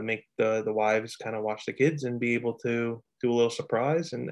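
ordinary delivery

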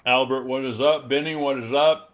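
talking around a piece of ice; ice in mouth. Real, not acted. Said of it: "Sorry, I got a piece of ice in my mouth".

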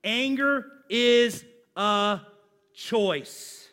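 slow, drawn-out, almost sung; preacher lays anger is a choice out slowly; emphatic drawn-out. Shown as a demonstration. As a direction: stretch each word out for the congregation. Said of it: "write this down".

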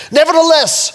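high, loud scripture proclamation; full-force preaching. Real, not acted. Preacher reads verse sixteen to congregation, full-force preaching. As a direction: call the verse out to the whole room.

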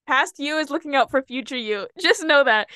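usual speaking voice

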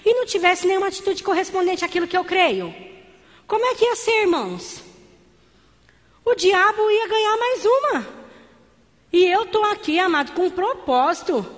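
rousing sermon climax; fervent preaching. Acted. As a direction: preach it out with fire to the church. Preaching at full force.

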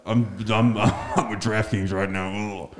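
lightly voiced grumpy phone answer; slow, drawn-out mock irritation; Grant. Friend who's always mad answering a call, mock grumpy. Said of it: "You know, he's always mad".

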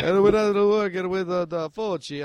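mock Italian accent, deliberately bad. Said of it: "the worst Italian accent I've ever heard".

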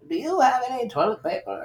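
high, soft put-on woman's voice; woman in story. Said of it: "She said".

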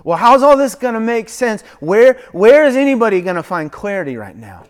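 loud, high, pleading questions; anxious plea. Retold or imitated. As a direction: press God for answers, pleading. Voicing an anxious believer.

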